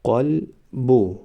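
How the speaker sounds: قلبو stretched very slowly, syllable by syllable; drawn-out demo. Shown as a demonstration. Teacher modelling the word for students, very slow. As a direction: draw out قلبو slowly for the class. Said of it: "ما باید همان حرف را فقط آوازش را تلفظ کنیم".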